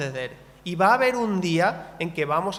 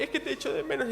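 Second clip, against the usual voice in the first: higher tearful child voice, mock weepy; imitating crying daughter; 8 semitones higher, 5 dB quieter, faster than usual. Father retelling his five-year-old's goodbye, imitating her tearful voice. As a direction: mimic a little girl missing her dad. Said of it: "llorando así".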